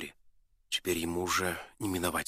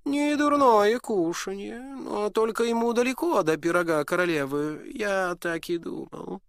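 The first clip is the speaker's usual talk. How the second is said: polite disdain, talking down; condescending smile; 11 semitones higher, 6 dB louder than usual. Acted. Prince to the duke, a condescending smile. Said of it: "снисходительно улыбаясь".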